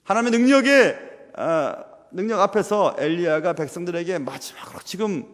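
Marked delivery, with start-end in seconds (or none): none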